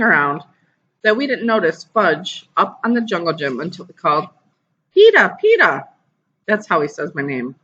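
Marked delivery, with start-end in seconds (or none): 4.9-5.9 put-on high, loud child call; high little boy yell; toddler calling out. A narrator's reading. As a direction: shout for big brother from above. Toddler calls from jungle gym.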